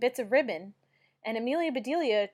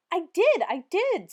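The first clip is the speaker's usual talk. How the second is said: eager insistence; 8 semitones higher, 6 dB louder, slower than usual. A narrator's reading.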